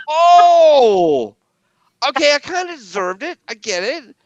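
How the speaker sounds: high, loud, hammed-up sheepish reply; mock embarrassed surrender. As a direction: concede like you got caught. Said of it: "And I'm like".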